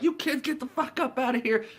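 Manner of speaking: put-on high-pitched scolding of kids; mock angry guard. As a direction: act out chasing kids off, high-voiced. Guard chases kids, retold.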